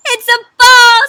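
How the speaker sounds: high, very loud dog cheer; thrilled reveal. A narrator's reading. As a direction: shout it with delight. Dog reveals its favorite gift.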